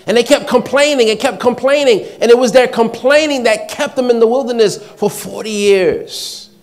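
loud, high, driving sermon delivery; full-force preaching. Real, not acted. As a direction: hammer it out to the congregation.

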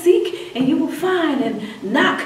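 laughing quote. Real, not acted. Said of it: "The Bible says".

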